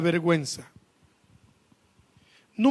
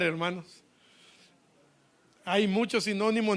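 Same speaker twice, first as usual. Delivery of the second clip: ordinary talk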